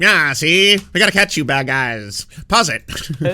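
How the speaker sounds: put-on high, loud old-timey gangster voice; 1950s narrator. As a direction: bark it like a forties movie tough guy. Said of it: "You gotta talk in a nineteen forties voice".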